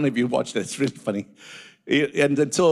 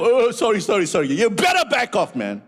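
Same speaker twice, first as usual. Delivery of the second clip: cowering apology; 6 semitones higher, 3 dB louder than usual. Retold or imitated. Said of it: "that coward just backed up".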